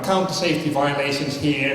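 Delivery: ordinary talk, nothing marked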